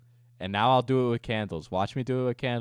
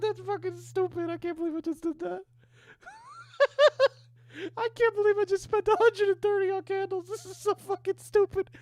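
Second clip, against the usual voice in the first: high-pitched, laughing through the words; cracking up in disbelief at his own spending. Real, not acted. Streamer laughing at himself over pricey candles, high and giddy.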